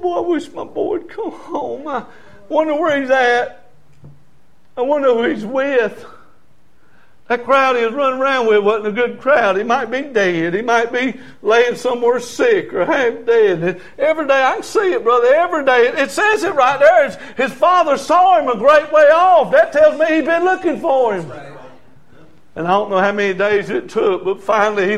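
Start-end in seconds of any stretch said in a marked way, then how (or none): 0.0-6.0 higher-pitched, lightly acted worried father; wishful, anxious dad voice; longing father. Acts out father longing for son. 7.3-21.3 high, building pulpit fervor; raised-pitch preacher in full flow; impassioned preaching. Real, not acted.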